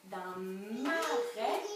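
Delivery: "marais" dit très lentement, syllabe par syllabe; étirer "marais" pour qu'on entende ses deux syllabes; mot étiré. Shown as a demonstration. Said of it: "j'entends deux syllabes". ("marais" très lent, qui monte)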